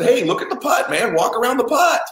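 high, strained urging; insistent sideline-dad plea; urgent coaching. Retold or imitated. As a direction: press the boy to do it, pitch way up. Dad urgently coaching his son.

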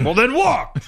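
high-pitched, exasperated retort through a laugh; exasperated order. Retold or imitated. Retold cops to passenger who won't walk, exasperated. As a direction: push it up high, mock-exasperated.

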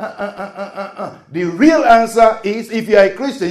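ordinary talk, nothing marked

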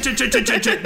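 high, rapid-fire "chit" noises; imitated mic chatter. Joking about a streamer driving past, high imitated chatter. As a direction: mimic a streamer rattling away in a high voice. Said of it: "hear him on the mic going past".

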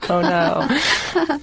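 laughing through the words; real giggle around a playful protest; amused. Real, not acted. Author and host joking about book four, laughing.

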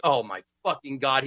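ordinary talk

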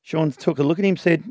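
usual speaking voice

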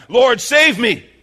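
loud, high, drawn-out plea for rescue; crying out. Acted. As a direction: cry out as if sinking under. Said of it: "and he cried out".

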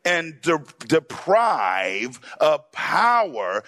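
very slow, word by word repeat. Shown as a demonstration. Preacher repeating the verse slowly, slow and deliberate. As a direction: spell it out slowly so they catch it. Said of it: "Say it again".

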